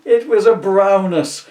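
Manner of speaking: ordinary delivery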